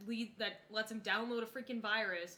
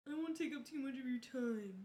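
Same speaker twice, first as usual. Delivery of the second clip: ordinary delivery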